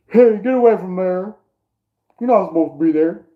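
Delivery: high, loud mock scolding; fake voice. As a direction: shoo them away in a put-on voice. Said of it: "like, a fake voice".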